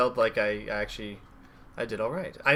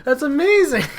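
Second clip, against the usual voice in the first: very high, loud wonder; mock awe; 13 semitones higher, 10 dB louder than usual. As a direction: squeal it like an amazed kid. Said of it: "in my head I was like".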